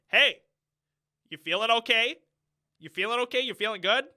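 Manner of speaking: loud, flat check-in with no feeling behind it; mock a wooden father asking how she feels; imitating Adam Driver's flat delivery to his daughter; mocking a monotone dad. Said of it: "there's no, like, toning to his voice".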